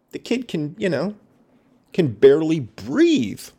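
real outrage, voice shooting up; outraged rant. Real, not acted. Reader outraged by child abuse.

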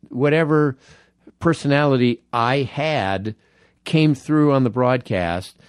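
ordinary delivery

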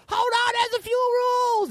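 very high shouted announcer voice. Acted. Comic as club announcer halting the cheers, high shout. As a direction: holler it over a roaring crowd.

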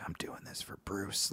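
breathy whisper to himself; a hushed, devoted whisper; whispered inner vow. Retold or imitated. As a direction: whisper it like a secret promise. Inner vow while entering gym.

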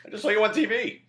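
high, loud, gushing; excited relative. Retold or imitated. Relatives texting after the Golden Globes, excited. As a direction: squeal it like a thrilled aunt.

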